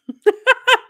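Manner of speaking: a sudden high burst of laughter; laughing hard at her own joke; loud high laugh. Real, not acted.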